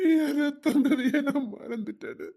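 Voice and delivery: broken, crying self-reproach; sobbing lament. Acted. Father sobbing to his daughter over his neglect. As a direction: weep it out over lost child.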